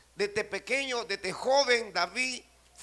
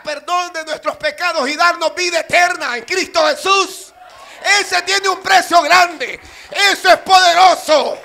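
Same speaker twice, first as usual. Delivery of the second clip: full-force preaching; 7 semitones higher, 13 dB louder than usual. Acted. Preacher proclaiming the price Christ paid, shouted preaching. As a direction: proclaim it louder and louder to a roaring peak.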